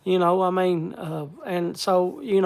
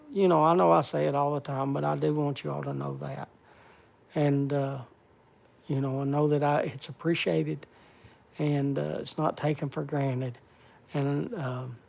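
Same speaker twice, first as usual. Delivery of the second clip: holding back tears; 4 semitones lower, 8 dB quieter than usual. Real, not acted. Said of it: "starting to tear up".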